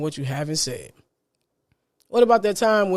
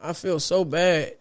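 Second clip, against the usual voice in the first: low, soft, sorry-for-yourself groan; mock-miserable sick person's complaint; acted sick moaning; 3 semitones lower, 5 dB quieter, slower than usual. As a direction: act out feeling poorly, voice sinking and dragging. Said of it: "when you say it like that".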